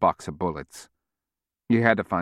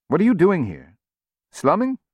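surprised; 11 semitones higher, 4 dB louder than usual. Acted. Kabir surprised to see Norman.

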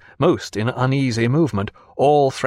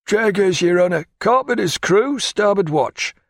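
higher, brisk reporting; old seaman; 8 semitones higher than usual. A narrator's reading. Old sailor answering the captain, higher and brisk. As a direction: snap out name and post to the captain.

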